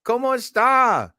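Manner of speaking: high, bright hello to a guest; cheery greeting voice. Retold or imitated. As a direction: greet her sing-song. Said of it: "Lo was just able to".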